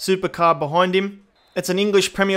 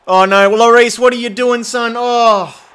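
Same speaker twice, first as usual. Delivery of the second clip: loud, high cry of dismay; dismayed outburst; 6 semitones higher, 9 dB louder, slower than usual. Real, not acted. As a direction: yell in real disbelief at the keeper's blunder. Goalkeeper blunder in game.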